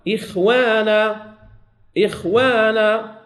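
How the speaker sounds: إخوانا stretched out slowly, the خ kept light; slow model recitation. Shown as a demonstration. Teacher modelling إخوانا for the class, slow and deliberate. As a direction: draw out إخوانا carefully to model it. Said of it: "لما نقول إخوانا".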